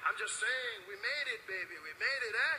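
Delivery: brotherly pep talk; Mexican accent. Retold or imitated. Mexican man claiming Trevor as his kind, loud Mexican accent. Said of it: "He said".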